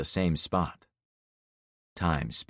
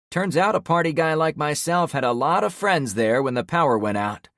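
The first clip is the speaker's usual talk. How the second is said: higher, loud defiant ranting; angry boasting; 8 semitones higher, 6 dB louder than usual. Acted. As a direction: shout him down with contempt. Trevor boasting angrily.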